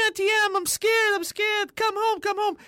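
high, mocking witch imitation. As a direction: mimic the witch mocking Dorothy. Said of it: "the witch appears and says".

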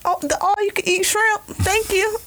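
high, sing-song innocent reply; mock innocent. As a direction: play the clueless birthday girl. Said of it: "And I'm like".

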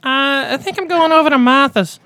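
very high imitated uncle voice; John. Host imitating a mutual uncle's famous line, high put-on voice. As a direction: quote him in a squeaky high Boston voice. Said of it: "our mutual uncle John's".

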